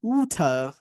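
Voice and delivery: British "water" as oo-tuh, no r; British accent. Shown as a demonstration. Teacher shows what British people say, British accent. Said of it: "এটাকে ব্রিটিশরা কি বলে জানেন?".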